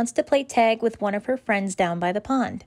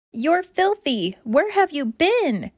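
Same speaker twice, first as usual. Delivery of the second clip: higher, slow astonished scolding; shocked mom; 7 semitones higher, slower than usual. Acted. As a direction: gasp at the muddy puppy. Mom sees her muddy dog.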